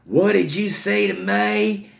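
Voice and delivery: high, slow mock outrage; lit-up babysitter. Babysitter answers a toddler's threat, high mock fury. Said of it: "she just lit up".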